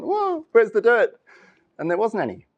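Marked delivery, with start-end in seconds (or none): 0.0-1.2 higher, slow, lost little roots calling out; confused roots. Pretend. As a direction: play the roots searching, bewildered and higher.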